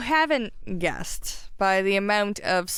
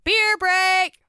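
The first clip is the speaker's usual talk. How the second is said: shouted much higher and louder; yelled announcement; 10 semitones higher, 5 dB louder than usual. Real, not acted. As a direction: belt out the call. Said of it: "me yelling".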